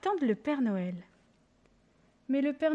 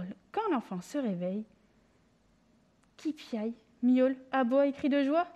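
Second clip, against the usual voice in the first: usual speaking voice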